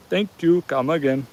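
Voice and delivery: lightly put-on Indian accent; Apu impression. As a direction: imitate a cartoon Indian shopkeeper accent. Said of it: "could have been like the Apu".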